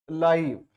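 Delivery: लाइव with an eye vowel; लाइव as in a live match; long-i pronunciation. Shown as a demonstration. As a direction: say लाइव to rhyme with five. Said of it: "दूसरे वाला को बोलेंगे".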